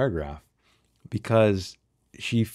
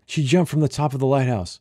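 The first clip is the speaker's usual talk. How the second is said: higher, louder call; 6 semitones higher, 3 dB louder than usual. Acted. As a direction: shout the explanation across. Calling back across water.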